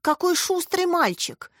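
put-on high, slow elderly woman's remark. A narrator's reading. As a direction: remark slowly in a high older-lady voice. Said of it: "заметила пожилая женщина на входе". (пожилая женщина, высоко и протяжно)

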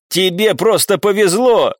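understated shout at a rival; indignant shout. A narrator's reading. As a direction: call out the accusation, drawn out. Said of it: "крикнул Леон".

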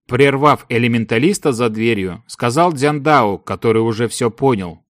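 ordinary delivery, nothing marked

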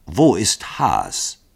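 sehr langsam und gedehnt wiederholt; Wo ist Haas? Wort für Wort gedehnt wiederholen. Acted. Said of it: "wiederholte Dragomilow". (gedehnt nachdrücklich wiederholt)

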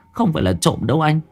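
high, fast, frightened pleading; wife tells her husband it is her sister's ghost, high and frightened; terrified insistence. Acted.

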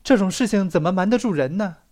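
ordinary delivery

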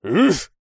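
loud, high grunt of a swing; effort grunt. A narrator's reading. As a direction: grunt as you swing a heavy blade. Мол бьёт тесаком. (крякнуть с размаху)

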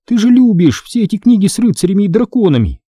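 high-pitched sisterly grumble; grumbling. A narrator's reading. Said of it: "проворчала Эми". (ворчливо, высоким голосом)

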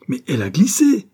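loud, high-pitched indignant roar. A narrator's reading. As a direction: roar it out in protest. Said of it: "rugit Bart". (rugissement indigné, fort et aigu)

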